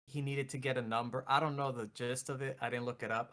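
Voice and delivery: usual speaking voice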